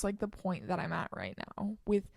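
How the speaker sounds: usual speaking voice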